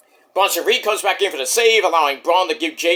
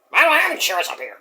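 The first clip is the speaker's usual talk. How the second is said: fast, higher voice from the beyond; ghostly Terry voice; 5 semitones higher, much faster than usual. Acted. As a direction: quip from heaven, hurried. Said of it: "At least it doesn't".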